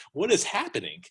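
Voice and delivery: ordinary delivery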